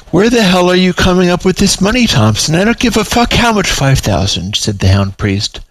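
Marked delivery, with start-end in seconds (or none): 0.1-4.6 high-pitched, indignant demand; exasperated outburst. A narrator's reading. Haggling quarrel, client keeps raising money.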